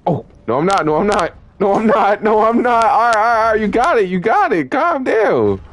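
very high, loud frantic backing-off; panicked yelling. Real, not acted. Player retreating from someone who hates close range, panicked yelling. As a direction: yell repeatedly to placate an attacker.

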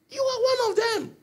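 very high, strained accusation; accusing soldier. Acted. Soldiers accuse Peter by fire.

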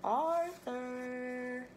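long, drawn-out singsong name; waiting, singsong "Arthur"; calling out. A narrator's reading. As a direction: call for him with stretched syllables. Waiting Francine calls out.

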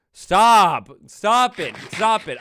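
startled shout. Real, not acted. Startled by a noise.

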